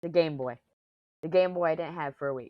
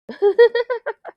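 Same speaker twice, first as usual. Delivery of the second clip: loud laugh; 14 dB louder than usual. Real, not acted. Laughs at own impression.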